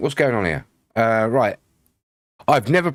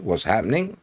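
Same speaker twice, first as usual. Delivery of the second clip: ordinary talk, nothing marked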